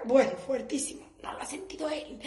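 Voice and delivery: ordinary talk, nothing marked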